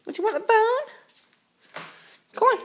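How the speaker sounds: very high, slow coaxing; talking to fish. Pretend. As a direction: stretch it out, squeaky. Repeats in high voice.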